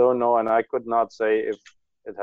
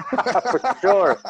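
laughing. Real, not acted. Laughing at transformer joke.

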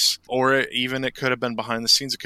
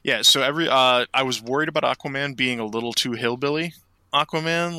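ordinary talk

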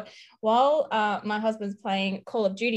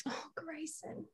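soft, slow, higher dreamy sigh; mock adoration; 6 semitones higher, 18 dB quieter than usual. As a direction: swoon over his name. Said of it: "I'm swooning".

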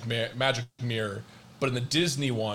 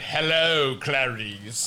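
mock Lecter voice; much slower than usual. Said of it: "It wasn't like".

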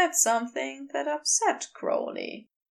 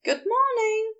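bright, much higher good morning; upbeat greeting; 10 semitones higher than usual. Acted. Aziraphale greeting his neighbour with an upbeat hello. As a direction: chirp it out cheerfully. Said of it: "greeted him with an upbeat".

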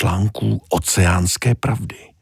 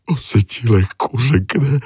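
sad sigh; 7 semitones higher than usual. Acted. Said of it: "smutně vzdechl Tony".

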